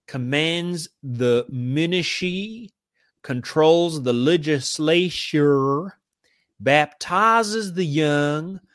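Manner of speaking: slow, drawn-out mock South American accent; jokey South American drawl reading an old quote. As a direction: stretch every phrase in a lazy South American drawl. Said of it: "it's South American drawl".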